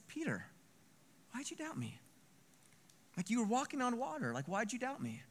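hushed, high-pitched gentle questioning; tender disbelief. Acted. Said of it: "I see it as".

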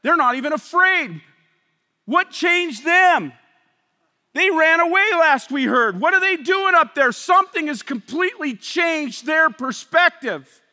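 full-force preaching. Real, not acted. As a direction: build to a shout.

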